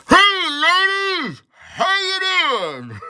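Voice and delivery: very high, hugely stretched-out greeting; hammed-up squeaky come-on, every word dragged out; mocking catcall. Johnny calling back to the ladies in the alley, exaggerated catcall.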